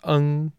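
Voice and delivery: "eng" held high and level in first tone. Shown as a demonstration. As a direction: sing "eng" high and flat. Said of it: "if it's first tone".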